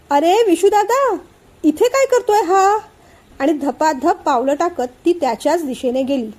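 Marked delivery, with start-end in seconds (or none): 0.1-2.8 put-on girl spots her brother on his bike and calls out, surprised. A narrator's reading. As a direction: call out across the campus, surprised. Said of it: "Vaidehi जवळजवळ ओरडलीच".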